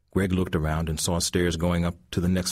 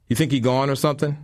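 quick, higher thug question; intruder voice; 8 semitones higher, 5 dB louder, faster than usual. A narrator's reading. Thug wondering if the old man left, quick and high. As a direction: rush the question to your partner.

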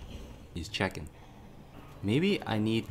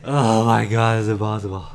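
frustrated shout; 11 dB louder than usual. Real, not acted. Pursuer keeps finding him.

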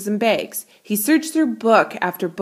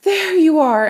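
relieved; 8 semitones higher, 6 dB louder than usual. A narrator's reading. Relieved mother answers.

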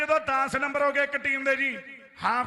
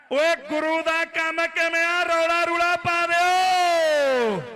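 hyped commentary shout; 3 semitones higher, 4 dB louder, slower than usual. Real, not acted. Kabaddi commentator hyping crowd.

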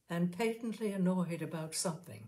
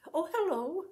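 higher, louder, sugary greeting; sweet call; 11 semitones higher, 5 dB louder than usual. A narrator's reading. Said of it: "she called sweetly".

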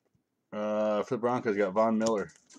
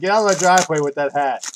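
much louder, higher mock yell at him; mock shout; 5 semitones higher, 10 dB louder than usual. To the house owner in a Dallas hat, mock shouting. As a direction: bellow it like you're chasing him off. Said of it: "I'm like".

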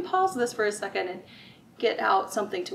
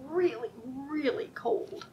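slow whisper; 3 semitones higher, 5 dB quieter, slower than usual. Acted. Reading picture book to children.

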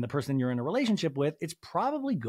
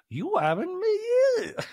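much higher, slow, cracking mock voice; exaggerated impression; slower than usual. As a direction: stretch it high and warbly like a parody. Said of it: "I don't know how to do it".

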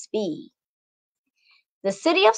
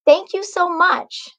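higher heartfelt thank you; grateful thanks; 8 semitones higher than usual. A narrator's reading. Mother thanking Macy for dinner, grateful.

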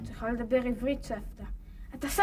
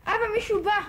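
loud call to another room; 6 semitones higher, 6 dB louder, much faster than usual. Acted. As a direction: call out to father. Child calls father to door.

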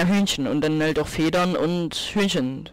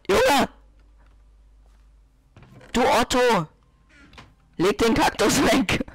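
shouting; 7 semitones higher, slower than usual. Pretend. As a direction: yell it at the screen. Yelling at game character.

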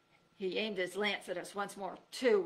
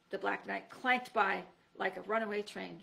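ordinary delivery, nothing marked

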